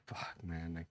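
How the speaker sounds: breathy sighed curse under the breath; exasperated whisper. Real, not acted. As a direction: let it out as a tired whispered sigh. Frustrated sigh about clients.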